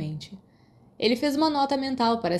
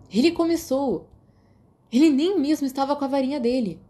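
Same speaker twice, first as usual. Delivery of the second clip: high, raised protesting voice; boy insisting the other one started it; defensive protest; 7 semitones higher, 4 dB louder than usual. Acted. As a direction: justify yourself quickly. Sirius defending himself.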